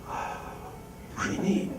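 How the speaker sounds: hushed, wide-eyed whisper; whispered amazement. Retold or imitated. Patient awake during angiogram, whispering in wonder. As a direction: breathe it out in awe.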